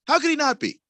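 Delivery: P=A; mocking high voice. Repeated with altered voice.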